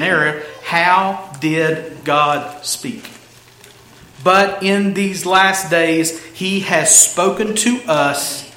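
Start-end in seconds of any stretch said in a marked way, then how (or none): 4.2-8.4 slow, deliberate phrases with pauses; weighty scripture reading. Real, not acted. Preacher reading the key verse to the church, weighty. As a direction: set each phrase apart, slow and measured.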